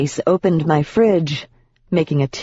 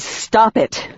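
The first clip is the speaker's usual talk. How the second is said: higher, more forceful Stop it!; sharper demand; 6 semitones higher than usual. Acted. Rachel forcing the demon to stop, a raised demand. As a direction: snap it out, fed up this time. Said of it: "I said".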